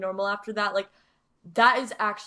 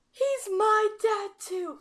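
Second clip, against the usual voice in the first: much higher, slow, near tears; quoting Natasha; 11 semitones higher, slower than usual. Retold or imitated. Friend quotes Natasha's tearful line, high and slow. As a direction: say it high and pleading, slowly. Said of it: "When she said".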